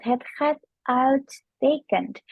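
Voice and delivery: "uitstekend" with ui said like au, slow and careful; slow Dutch demo. Shown as a demonstration. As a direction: drag out "Het gaat uitstekend" word by word. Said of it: "U dan I itu kita bacanya kayak au". (ucapkan pelan, ui dibaca au)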